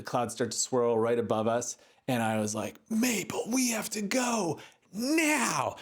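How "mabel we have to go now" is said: urgent call. Retold or imitated. Urgent call to the dog in storm.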